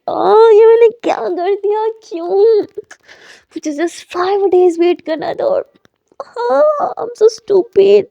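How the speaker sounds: loud, regretful groan of self-blame; frustrated self-scolding. Acted. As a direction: scold yourself for the mistake. Character regrets hanging up.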